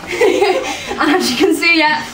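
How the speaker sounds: laughing at the bouncing dog. Real, not acted. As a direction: chuckle through the words.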